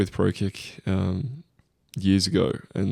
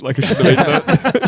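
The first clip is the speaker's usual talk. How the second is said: raised, louder voice breaking into laughter; laughing regret; 8 semitones higher, 9 dB louder than usual. Retold or imitated. Confessing fast-food regret to the hosts, laughing mock regret.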